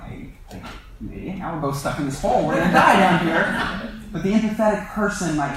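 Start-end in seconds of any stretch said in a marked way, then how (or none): 0.0-1.4 whispered under the breath, much softer; mock whisper. Stuck in the hole, grumbling at the rescuer, whispered. 1.4-3.9 playful doom spoken through laughter; laughing mock panic. Stuck in the hole with the rescuer, laughing mock despair.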